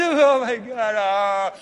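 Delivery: hammed-up tearful moan; drawn-out fake sob on the words; mock crying. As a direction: imitate a man blubbering. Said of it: "disciples are there crying too".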